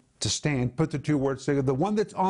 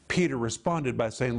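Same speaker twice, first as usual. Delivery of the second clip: ordinary delivery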